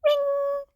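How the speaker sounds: high, even ringing tone; phone ring. Acted.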